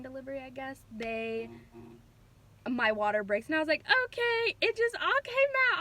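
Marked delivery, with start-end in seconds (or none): 4.0-5.8 high, alarmed retold cry; panicked. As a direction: relive the shock of the water breaking, pitched way up. Retelling her water breaking.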